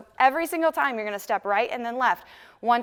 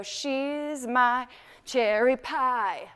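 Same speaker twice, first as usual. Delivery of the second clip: slow; much slower than usual. Shown as a demonstration. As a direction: stretch every word out slow. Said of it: "Slow, slow".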